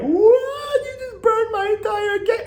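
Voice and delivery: high mock wailing whine; mocking shocked houseguest. Podcaster mocking Brittany's shocked crying reaction. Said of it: "Brittany's all, like, shocked by it".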